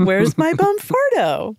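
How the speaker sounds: high, drawn-out comic wail of a question; mock plaintive searcher. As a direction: ham it up, slow and high-pitched. Hammed-up repeat of the question.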